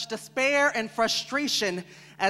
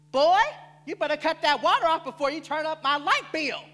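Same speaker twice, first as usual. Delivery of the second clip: put-on higher-pitched parent telling off a boy; scolding parent; 6 semitones higher, 4 dB louder than usual. Parent scolding about bills.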